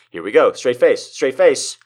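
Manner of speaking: usual speaking voice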